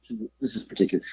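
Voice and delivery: fast breathless whisper; whispering on the phone. Pretend. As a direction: hiss it out quickly, unvoiced. Mock whisper on bad phone line.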